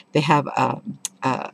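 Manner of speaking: ordinary talk, nothing marked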